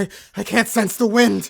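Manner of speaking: loud, high, frantic stammer; frantic fear. Acted. Blinded captain realizing he cannot sense the wind. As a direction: blurt it out in rising panic.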